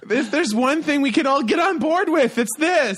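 high, loud gushing declaration; excited. Real, not acted. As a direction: proclaim it with giddy passion. Gushing proclamation about crush.

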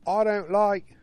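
high, slow, hammed-up British lady accent; mock British wife. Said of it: "The British guy. / And she's basically like".

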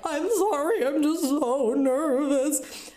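high-pitched tearful whine; mock sobbing. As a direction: imitate a drawn-out, weepy apology. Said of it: "I am sobbing like someone has died".

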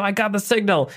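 a quick, bright, high call of discovery; excited call. Retold or imitated. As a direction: mimic Sayid calling out that he caught the signal. Sayid calling out to someone.

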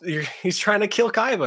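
ordinary delivery

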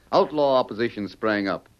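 ordinary talk

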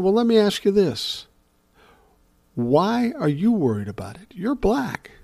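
incredulous question. Retold or imitated. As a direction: raise the voice as if it makes no sense.

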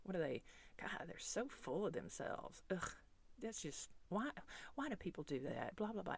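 soft, hushed, judgmental murmuring; hushed gossip. Retold or imitated. Shoppers gossiping about the selfie-taker, in hushed murmurs. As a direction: mutter disapproval under your breath. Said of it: "kinda whispering at each other like".

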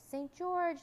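high, loud cheering cry; crowd's cry. A narrator's reading. Crowd hails its new hero, high cheering shout. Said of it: "they cried".

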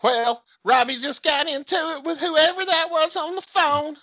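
very high-pitched mom voice; imitated mom. Mom explains the phone fight, imitated high voice. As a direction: mimic a worked-up Southern mom. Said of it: "he's like".

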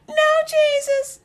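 very high falsetto woman's reply; mock-feminine squeal, far above your voice; voicing the woman. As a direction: answer Jesus in a comic, piping woman voice. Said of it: "She like".

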